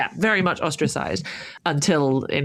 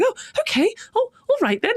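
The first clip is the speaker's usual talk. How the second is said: much higher, airy brush-off; breezy Vimes. Retold or imitated. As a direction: toss it off up high as if nothing odd. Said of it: "he's going".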